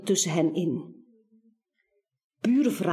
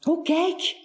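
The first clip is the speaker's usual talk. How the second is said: bright higher call of surprise; excited; 7 semitones higher than usual. A narrator's reading. Narrator excitedly draws the listener to the opening door, higher. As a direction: point it out eagerly, pitch up.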